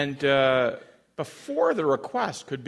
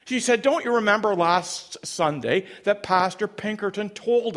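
higher, forceful voicing of an objection; heated rebuttal; 6 semitones higher, 4 dB louder than usual. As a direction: argue back with insistence, as a retold protest. Amber argues back against Scarlett.

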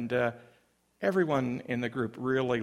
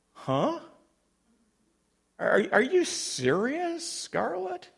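much higher, rising disbelief; acted incredulity; 12 semitones higher than usual. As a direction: question her as if you can't believe your ears. Amber challenges Scarlett in disbelief.

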